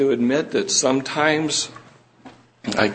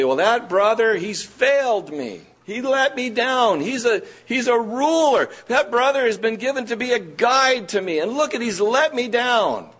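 a higher, aggrieved grumble, mildly acted; complaining believer; 8 semitones higher than usual. Preacher imitating a congregant who feels let down, mildly aggrieved. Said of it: "you might say".

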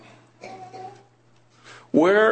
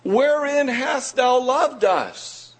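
much higher, slow, disbelieving; incredulous people; 10 semitones higher, slower than usual. Retold or imitated. Preacher re-voicing Israel's doubting question, higher and slower. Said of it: "Imagine those words".

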